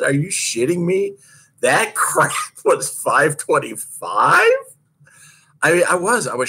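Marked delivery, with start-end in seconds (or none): 0.0-4.5 higher, with a laugh breaking in, slow at the end; laughing disbelief. Retold or imitated. Skater asking her coach to repeat the time, laughing and high. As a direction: ask it like the number can't be right. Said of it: "And I said".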